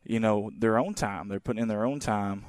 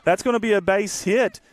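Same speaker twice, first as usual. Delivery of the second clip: much higher, louder rush of excitement; excited play call; 8 semitones higher, 5 dB louder than usual. Real, not acted. Announcer calling a hit for stream viewers, excited and loud. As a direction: call the hit like it's a big moment.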